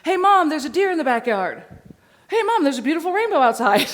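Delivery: high, eager, loud dog voice; the excited dog reporting everything; voicing the dog. Dog excitedly alerting its owner, high and loud. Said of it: "I want him to go".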